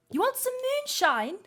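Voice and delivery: high, fast offer of a drink; bartender voice. Acted. Bartender offering moonshine to the party, high and quick. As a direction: pitch it up and rush the offer.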